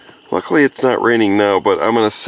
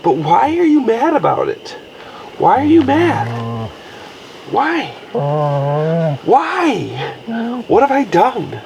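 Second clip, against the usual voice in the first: much higher mock-hurt questions to the dog; high pet voice; 10 semitones higher than usual. Real, not acted. Owner asking grumpy dog Monty why he's mad, high and mock-hurt. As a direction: plead with the dog in a high, wounded sing-song. Dog groans at towel drying.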